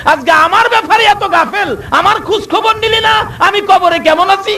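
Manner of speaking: high, fast wailing reproach; grieving dead mother. Retold or imitated. Dead mother reproaches her child from the grave, wailing. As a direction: wail at the neglectful son from the grave.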